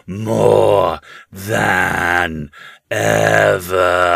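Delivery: slow, loud, eerie chant of the house; the house itself screaming for money in ecstasy; ecstatic house voices. A narrator's reading. The haunted house crying out for money, slow and loud. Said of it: "trilled and screamed in a sort of ecstasy".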